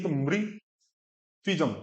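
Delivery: मृत्विजम slowly with a clear ऋ as ri after म; clear ri sound. Shown as a demonstration. Teacher shows the ri under म to student, slow. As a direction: stress the ri in मृत्विजम. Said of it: "म के नीचे री है".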